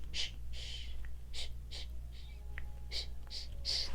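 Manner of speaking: soft breathy shh, shh; quiet whispered shushing. Pretend. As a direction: hush like a mommy calming babies. Mommies on the bus go shh.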